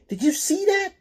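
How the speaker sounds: high, loud astonished cry; amazed. A narrator's reading. Thug amazed at the stranger, high excited cry. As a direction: voice jumps up in disbelief. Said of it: "said Zos".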